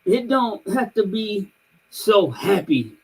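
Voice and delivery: put-on high little-girl voice, searching for the word; a small child finishing her point; imitating little niece. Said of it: "she's like".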